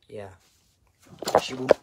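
ordinary delivery

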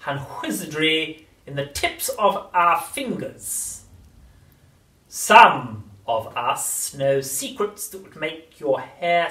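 very slow, throaty, menacing crone; throaty witch whisper. A narrator's reading. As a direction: lean in and menace. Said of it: "whispering in a throaty sort of voice".

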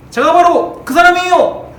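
loud, slow and proud, with the weight on 제가; bold confession. Pretend. Peter boldly owning that he knows Jesus, loud and proud. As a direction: own up proudly and loudly. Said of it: "라고 했으면 얼마나 좋아요".

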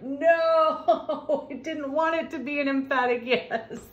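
high, laughing mock protest. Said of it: "their experiences is like".

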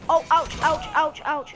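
loud, high string of yelps; yelping in pain. Real, not acted. Gamer getting shot in the match, loud high yelps. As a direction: yelp each word as the hits land. Being hit in game.

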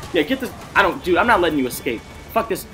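ordinary talk

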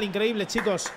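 normal talking voice

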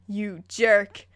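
loud, furious breakup shout; angry yell. A narrator's reading. Alexis catching her cheating boyfriend, furious shout. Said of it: "I yell".

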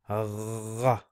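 gha rasped at the back of the throat like a French r; gha with phlegm in the throat; gargled gha. Shown as a demonstration. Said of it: "this is pronounced as gha".

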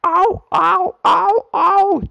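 high, loud squealing cries of toy trucks; screaming trucks. A narrator's reading. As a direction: shriek it high and loud like little trucks in pain. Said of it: "they cried again".

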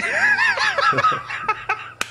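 long, squealing burst of laughter; real helpless high laughter; high-pitched laugh. Real, not acted.

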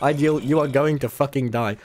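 ordinary talk, nothing marked

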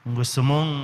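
normal talking voice

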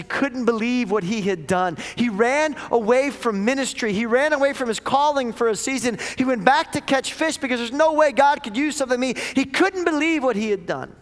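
heated sermon climb; impassioned preaching. Acted. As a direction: preach it with rising fire. Preaching at full force.

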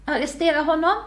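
high, strained order from a wounded man; wounded king's plea; weak command. A narrator's reading. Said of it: "beordrade kungen svagt".

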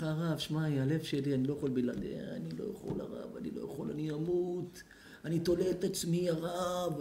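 soft, weepy imitation of a lovesick man; hammed-up heartbroken whimper; mock tearful lament. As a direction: plead weakly, as if heartbroken. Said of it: "על מה אתה בוכה".

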